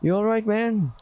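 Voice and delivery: lightly voiced friendly dingo checking in; Gil's voice. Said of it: "that's the voice".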